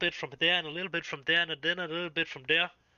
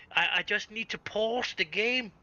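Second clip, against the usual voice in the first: higher-pitched quote with English "pawz"; imitated gamer; 5 semitones higher than usual. Mimicking a player stopping the game, imitated voice. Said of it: "where you say".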